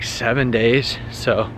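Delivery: ordinary delivery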